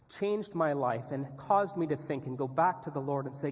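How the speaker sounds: ordinary talk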